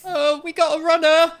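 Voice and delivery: high, loud alarm; excited call-out. Acted. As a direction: shout the alert cheerily. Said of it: "he just goes".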